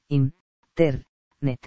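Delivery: in, ter, net said as three separate syllables with a short pause between each; syllables split apart. Shown as a demonstration. Teacher sounding out in, ter, net syllable by syllable. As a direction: break the word into in, ter, net slowly.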